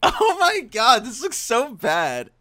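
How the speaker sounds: high slow squeal of dismay; shrieking laugh. Real, not acted. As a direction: shriek with laughter at a bad drawing. Sees own drawing, laughs.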